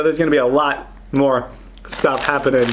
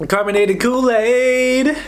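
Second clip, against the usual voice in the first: excited; 10 semitones higher, slower than usual. Real, not acted. Repeating with delight.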